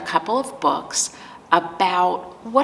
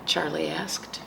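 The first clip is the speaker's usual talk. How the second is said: usual speaking voice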